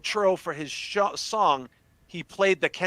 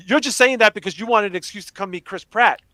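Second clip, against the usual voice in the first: higher, louder retold exasperation; mock accusation; 7 semitones higher, 6 dB louder than usual. Dad to daughter about meeting an actor, accusing. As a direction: act out a dad calling out the fib.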